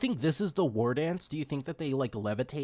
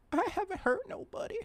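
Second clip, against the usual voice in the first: high, small pleading creature voice; cute high-pitched stoat voice; innocent little stoat; 10 semitones higher, 3 dB quieter than usual. Acted. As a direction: plead innocence as a tiny animal. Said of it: "He's like".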